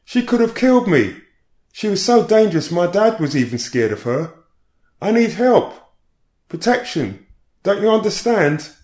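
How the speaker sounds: high, loud, frantic shouting; a woman begging the officers for help, shouting high. Retold or imitated. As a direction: plead loudly for protection. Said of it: "Suddenly she began shouting again".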